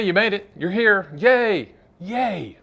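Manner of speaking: playful yay for the finish line; mock cheer. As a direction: celebrate the viewer finishing the class.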